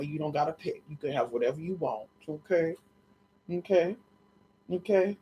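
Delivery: quietly cooing to her puppy as his mama. Real, not acted. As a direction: comfort the dog gently. Baby talk to her dog.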